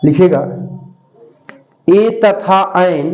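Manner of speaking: words spaced out slowly for copying; slow dictation. Acted. Teacher dictating notes to pupils, slow and spaced. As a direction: dictate each phrase slowly so pupils can write.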